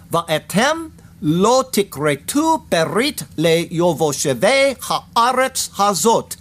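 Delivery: very slow, deliberate Hebrew, word by word; emphatic. Shown as a demonstration. Teacher showing that the Hebrew words are emphatic, slow emphatic recitation. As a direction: spell out "Va'etem lo tikretu" slowly, each word given its own weight. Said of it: "The words are emphatic".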